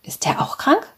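whispered question lifting high at the end. A narrator's reading. Daughter whispers back about her father, whispering. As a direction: keep it hushed and breath-only. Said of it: "flüstert sie".

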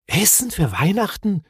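wondering question; puzzled repeat. A narrator's reading. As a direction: echo it in astonishment. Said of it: "wiederholte Mumin verwundert".

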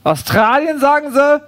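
high, rising echo question; put-on busybody doubting what she heard; incredulous neighbour. Neighbour incredulously echoes the answer.

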